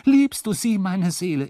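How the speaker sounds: high, loud pleading roar, peaking on liebst; desperate bellow. A narrator's reading. Drunk wife bellows her plea for love, high and loud. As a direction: howl the plea at him. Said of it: "Und dann brüllte ich wieder".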